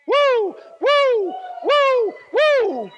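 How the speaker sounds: long, very high, loud woos; cheering whoops. Acted. The Father up there cheering for you, acted high whoops. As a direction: whoop loudly like a fan in the stands. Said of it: "the Father up there cheering for you".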